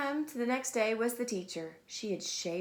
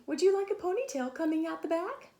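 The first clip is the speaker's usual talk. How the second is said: a hint of a coaxing mother, higher; gentle higher mom voice; lightly voiced mom; 7 semitones higher, 3 dB louder than usual. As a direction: offer it sweetly. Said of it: "Mom said".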